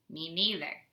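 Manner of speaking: ordinary talk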